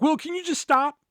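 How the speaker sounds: a touch of a high, sharp coworker snapping back; fed-up snap. Retold or imitated. Coworker tired of his joke, a mild mock snap. Coworker finally snaps at him.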